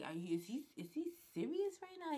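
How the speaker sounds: can't believe what she's hearing; incredulous question. Retold or imitated. Reacting to her boyfriend yelling on the phone, high incredulous disbelief.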